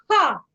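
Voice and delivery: loud high Ha blasted on the breath out; shouted Ha. Shown as a demonstration. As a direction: shout Ha from the belly. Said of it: "as loudly as you can".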